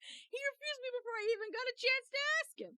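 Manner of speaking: high, fast and loud; laughing disbelief; incredulous. Real, not acted. Streamer complaining about the refusal, incredulous.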